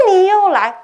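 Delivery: high loud laughing "you again?"; exasperated outcry. Retold or imitated. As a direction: throw up your hands at the ghost, laughing. 梦中惊叫鬼又来. (对着又来的鬼笑着高声惊叫)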